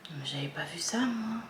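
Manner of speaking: whispered under her breath, amazed. Real, not acted. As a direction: breathe it out as a discovery. Marvelling at newly spotted details. (chuchoté, émerveillée)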